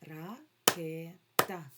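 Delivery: slow syllable by syllable РА-КЕ-ТА; drawn-out syllables. Shown as a demonstration. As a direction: stretch out Ракета to count the syllables. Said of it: "слово ракета складається із скількох". (повільно по складах)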